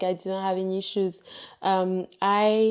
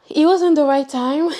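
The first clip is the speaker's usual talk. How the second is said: WASN'T hit loud and high; emphatic; 7 semitones higher, 9 dB louder than usual. Real, not acted. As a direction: push hard on the word wasn't. Emphatic aside before laughing.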